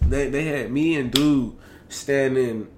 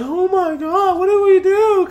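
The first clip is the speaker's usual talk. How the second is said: high, loud whiny panic; panicked recruit; 6 dB louder, slower than usual. Retold or imitated. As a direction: whine it like a scared recruit.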